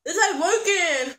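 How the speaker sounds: half laughing, half crying; laughing distress. Real, not acted. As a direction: laugh through the burn. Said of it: "I need some ice".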